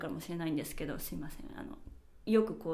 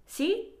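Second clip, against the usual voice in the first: し？ with the end raised; し？ lifting up at the end like a question; rising phone sí; 10 semitones higher, 5 dB louder than usual. Shown as a demonstration. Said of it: "ちょっと語尾を上げるんですよ".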